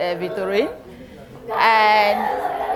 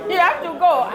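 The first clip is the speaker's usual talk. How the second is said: normal talking voice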